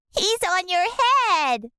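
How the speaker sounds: high, slow teasing; sister voice. A narrator's reading. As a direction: tell him smugly. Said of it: "my sister said".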